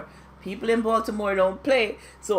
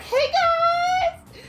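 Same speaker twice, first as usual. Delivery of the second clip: very high, sing-song "hey guys"; fake cheerful switch. Ashley greeting her guests in a high fake-cheerful chirp. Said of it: "she was like".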